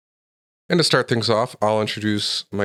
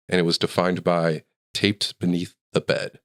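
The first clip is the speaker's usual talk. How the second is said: normal talking voice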